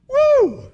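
a sudden, high, loud whoop. Real, not acted. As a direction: let out an overwhelmed woo. Overwhelmed recalling God's words.

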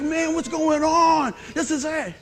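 high, slow, drawn-out hollering; excited shout. Retold or imitated. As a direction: yell it like a thrilled fan. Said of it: "But he was excited. He's like".

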